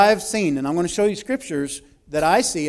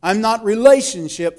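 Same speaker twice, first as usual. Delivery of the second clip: usual speaking voice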